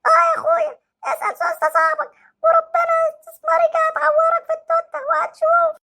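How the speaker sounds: put-on hurt boy threatening the doctor with his dad; sulky hurt child. Same child.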